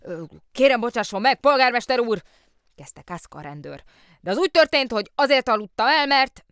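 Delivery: high, loud, hurried excuses; the policeman desperately excusing himself to the mayor; flustered policeman. Acted. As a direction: stammer out an apology in a rush.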